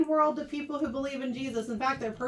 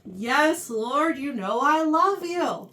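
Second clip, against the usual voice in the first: louder, slow, frustrated insistence; a little frustrated; 2 semitones higher, 6 dB louder, slower than usual. Acted. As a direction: stretch it out, frustrated at being asked. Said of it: "starting to get a little frustrated".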